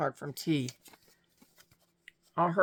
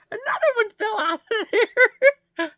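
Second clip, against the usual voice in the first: laughing through tears; 8 dB louder than usual. Real, not acted. Overwhelmed by card gifts.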